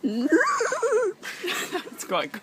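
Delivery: high, slow, laughing till crying; laughing hard. Real, not acted. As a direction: squeal through helpless laughter. Laughing at the horse noise.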